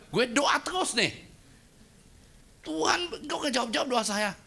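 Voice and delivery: high, complaining voice to God; whiny protest. Retold or imitated. Dead man protesting to God, high and whiny. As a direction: play the offended believer. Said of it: "Dia protes".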